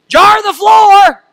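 high, loud shouted order, slowly drawn out; mom's wake-up holler. Retold or imitated. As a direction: yell it like a Southern mom waking her kid. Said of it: "my mom would holler".

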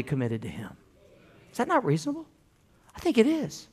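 suddenly very soft; hushed rhetorical question. Real, not acted. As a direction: drop right down to make them think it over. Rhetorical question dropped to a hush.

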